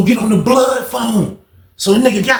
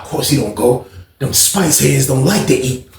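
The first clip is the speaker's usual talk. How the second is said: normal talking voice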